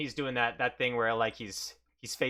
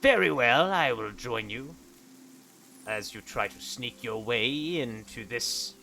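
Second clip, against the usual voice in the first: put-on stern old monk. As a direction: look down on your weak pupil. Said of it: "And Typhus says".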